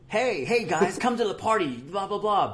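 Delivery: high, loud hype-man radio promo voice; hyped party promo. Retold or imitated. Radio guy hyping a party promo, high and loud. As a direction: pump it up like a party station ad. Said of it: "where it's all".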